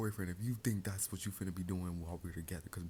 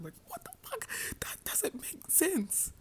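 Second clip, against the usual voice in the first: very high, slow, breathy disbelief; exasperated squeal; slower than usual. Retold or imitated. Exasperated quote to boyfriend.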